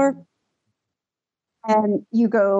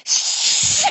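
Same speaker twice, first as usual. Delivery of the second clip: long, sloppy "shh"; drunken shushing imitation. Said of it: "elevator full of drunk people".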